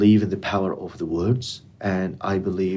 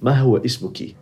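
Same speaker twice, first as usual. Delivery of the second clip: formal Arabic question, a touch higher; formal Standard Arabic; 4 semitones higher, 3 dB louder, much faster than usual. Shown as a demonstration. Interviewer testing guest in formal Standard Arabic. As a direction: ask her name in proper classical Arabic. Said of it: "I'm gonna speak the formal now".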